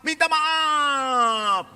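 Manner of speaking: high, long-drawn classroom answer; children's chorus reply. Retold or imitated. Priest imitates children answering what to do, drawn-out chorus. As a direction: stretch it out like children answering together.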